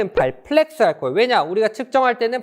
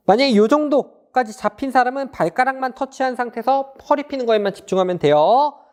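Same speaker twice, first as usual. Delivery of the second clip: ordinary delivery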